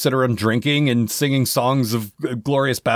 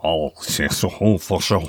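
put-on French accent; 5 semitones lower than usual. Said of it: "His French accent is so thick".

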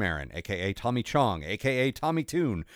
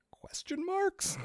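whispered aside; 6 dB quieter, much slower than usual. Acted.

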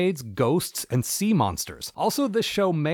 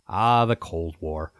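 low, slow nostalgic sigh; wistful sigh; 8 semitones lower, slower than usual. Pretend.

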